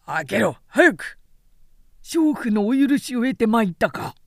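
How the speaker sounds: loud urgent calls; rising loud demand; shouted exchange. Acted. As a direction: shout through the gate. Shouting through the gate.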